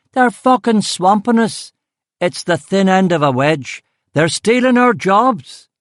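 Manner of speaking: high, loud ranting yell; a mob member shouting his grievance at Duffy, angry and loud. Acted. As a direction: rage at the outsiders, loud and high.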